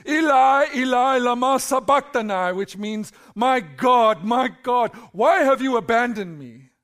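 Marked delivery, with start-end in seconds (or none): 0.1-2.5 loud, high anguished cry out. Acted. Jesus on the cross crying out to God, loud anguished cry. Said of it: "called out with a loud voice". 3.3-6.7 loud, ringing anguished plea; loud cry. Acted. Jesus forsaken on the cross calls out, loud anguished plea. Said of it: "called out with a loud voice".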